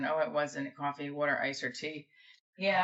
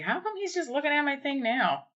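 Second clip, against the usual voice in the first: incredulous quote; 10 semitones higher than usual. Retold or imitated. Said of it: "And I was like".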